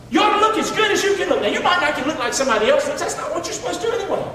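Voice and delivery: quick, high, with laughter; laughing. Real, not acted. Pastor joking about looks, fast and laughing. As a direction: rattle it off chuckling.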